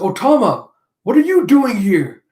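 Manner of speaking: high, loud astonished question; startled to see the kid arrive; surprised greeting. Acted. Nami surprised at Tama's arrival.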